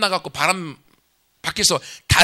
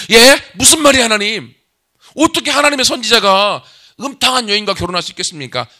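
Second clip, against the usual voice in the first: loud, high, disbelieving outcry; shocked protest; 5 semitones higher, 8 dB louder than usual. Acted. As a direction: protest in shock as if the order is unthinkable. Hosea protests God's order. (놀라서 높고 크게 항의하는 목소리)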